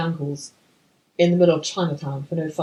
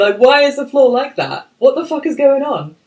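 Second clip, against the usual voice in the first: loud, higher, exasperated disbelief; exasperated rant; 8 semitones higher, 10 dB louder than usual. Real, not acted. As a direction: rant at how pointless it is. Ranting about pointless scene.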